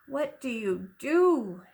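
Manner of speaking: ordinary delivery, nothing marked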